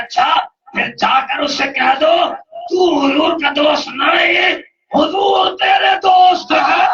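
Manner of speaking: slow climbing pulpit shout, near tears; rising preached shout. Acted. Preacher quoting the Prophet's reply.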